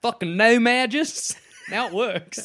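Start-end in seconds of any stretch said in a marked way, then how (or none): 0.0-1.4 slow, drawn-out Southern American twang; mock Southern accent, lingering on "no". Shown as a demonstration. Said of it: "say it with, like, a southern accent".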